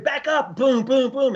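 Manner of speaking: high called-out command and booms; mock combat shout; retold shout. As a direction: call it out like under fire. Imitating cover fire.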